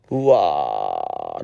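a deep, very slow "うわー" dropping far down; low drawn-out groan. Real, not acted. Host moved by a listener letter, groaning low and long. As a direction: groan it out, overwhelmed.

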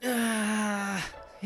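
long, soft idle groan; bored sigh. Acted. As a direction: let out a drawn-out bored sigh. Left waiting in room, bored. (退屈そうな長いため息)

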